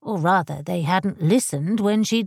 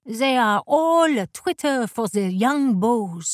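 slow, higher lilting madame; French dressmaker voice; 4 semitones higher than usual. Acted. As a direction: drawl it like a fussy Parisian modiste. Madame Lefebvre, voiced character.

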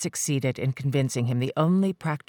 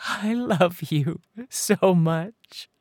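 very slow, tearful declaration; sobbing confession; 5 semitones higher, much slower than usual. Acted. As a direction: stretch it through a sob. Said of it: "With a sob".

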